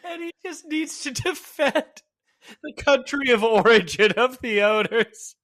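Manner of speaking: high, loud, hammed-up outrage; mock indignation. Man defending the bakery owners' origin, hammed-up indignation. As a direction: declaim it like a matter of national honour.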